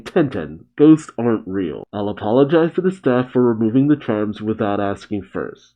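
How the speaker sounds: ordinary talk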